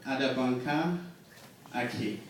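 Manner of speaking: ordinary talk, nothing marked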